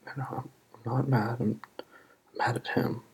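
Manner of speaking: usual speaking voice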